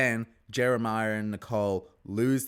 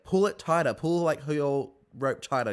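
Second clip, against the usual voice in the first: louder, higher urging; bossy command; 6 semitones higher, 5 dB louder than usual. Retold or imitated. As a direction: push a struggling teammate. Nicole barking orders at teammate.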